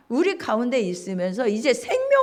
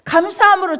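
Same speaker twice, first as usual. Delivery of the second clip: 감사함으로 proclaimed loud and higher, stretched 감사; louder 감사함으로; 6 semitones higher, 6 dB louder than usual. Shown as a demonstration. Preacher exhorting the congregation, loud 감사함으로. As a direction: ring out 감사함으로 with force. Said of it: "감사함으로 그 문에 들어가고".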